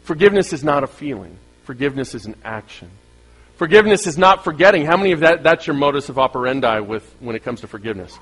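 ordinary delivery

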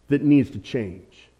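ordinary delivery

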